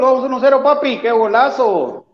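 shouted high goal call; breakneck goal commentary. Real, not acted. Calling a goal live.

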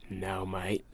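low, quiet Australian "No, mate"; a muted Aussie bloke brushing it off; Australian accent. Retold or imitated. A hint of an Australian accent, the hero telling the kid. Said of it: "was that a decent accent?".

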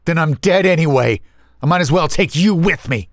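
high, heated threat; sudden fury. A narrator's reading. Younger brother threatening the older one, furious. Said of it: "my disappointment was replaced with fury".

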